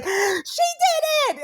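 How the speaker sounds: high, slow, gleeful "She did it!"; mock best friend boasting; excited announcing. Best friend announces Tess's news.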